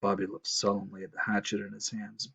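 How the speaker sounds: usual speaking voice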